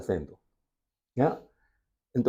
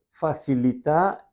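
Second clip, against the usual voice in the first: ordinary delivery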